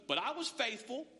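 usual speaking voice

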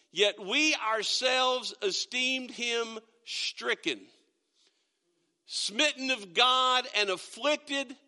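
slow, lifted and weighty, pausing between phrases; solemn scripture reading. Acted. Pastor reading the next verses to the church, slow and solemn. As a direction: let each line of the verse land slowly. Pastor reads Isaiah aloud.

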